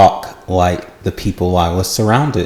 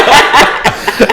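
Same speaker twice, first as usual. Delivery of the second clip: a sudden high laugh; embarrassed high laugh; high burst of laughter. Real, not acted. Laughs after being teased.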